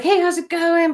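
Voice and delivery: high, bright mock hello; cheery greeting. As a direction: imitate a breezy party host. Imitating a greeting at the party.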